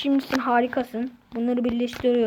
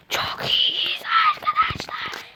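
breathy whisper to the viewers; slower than usual. Real, not acted. Gamer whispering to his viewers, hushed. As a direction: whisper it like a secret.

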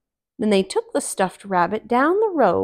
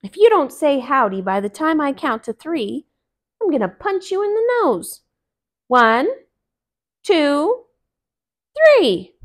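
high, loud angry rabbit threat; mad; 6 semitones higher, 6 dB louder than usual. Acted. Rabbit threatens the silent stuffed rabbit, angry. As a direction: count to three getting louder and angrier. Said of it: "Brer Rabbit was mad".

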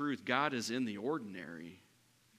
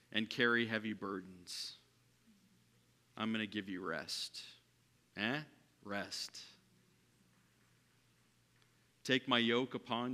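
gentle scripture reading; 4 dB quieter than usual. Acted. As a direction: offer rest to the weary tenderly. Reading Jesus's words gently.

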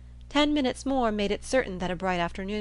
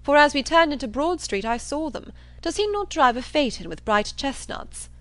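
raised, emphatic assertion; blustering John Thorpe; 7 semitones higher, 5 dB louder than usual. Acted. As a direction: insist with brash certainty. Thorpe contradicts with assurance.

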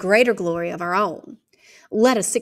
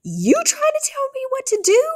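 much higher, loud indignant squeal; bristling retort; 6 dB louder than usual. Acted. Girl bristling at her bossy brother, high and loud. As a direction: rear up against her brother. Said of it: "My feathers rose".

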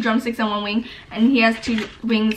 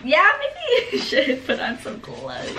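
laughing; 7 semitones higher than usual. Real, not acted. Teasing during spicy challenge.

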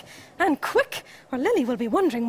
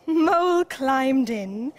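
ordinary delivery, nothing marked

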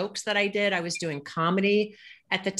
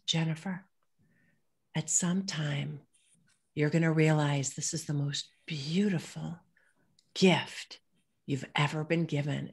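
gentle, slow and softer; 3 dB quieter than usual. Retold or imitated. Stranger consoling the cancer speaker, soft voice. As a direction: hand her the words softly. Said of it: "in a very soft voice".

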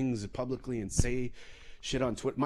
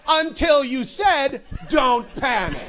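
high, loud, slowed mock outrage; indignant retort; 13 semitones higher, 9 dB louder, slower than usual. Passenger blaming the captain for the panic, loud indignant retort. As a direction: throw it back at him loudly.

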